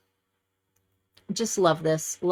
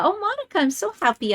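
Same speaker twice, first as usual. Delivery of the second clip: delighted; 11 semitones higher, faster than usual. Real, not acted. Delighted to find friend's card.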